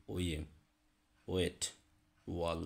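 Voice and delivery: ওয়ে ওয়েট ওয়াল with rounded w; w glide in ওয়াল; w sound. Shown as a demonstration. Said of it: "ডব্লিউর যে উচ্চারণ".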